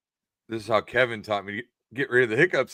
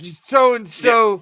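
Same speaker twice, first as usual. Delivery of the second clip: mock nagging wife; 11 semitones higher, 8 dB louder than usual. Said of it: "She'll be like".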